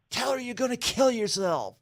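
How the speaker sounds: high-pitched imitated coaching from the bushes; urgent prompting buddy. Buddy hiding in bushes feeds suitor a line, mock high urging. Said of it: "the guy in the bush says".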